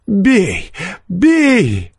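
very high, furious dare; fierce. A narrator's reading. Peasant to forester rising from seat, fierce voice. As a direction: dare him to strike. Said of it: "подхватил мужик свирепым голосом".

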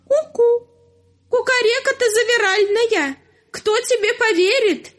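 high cuckoo mocking the rooster. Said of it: "прокуковала".

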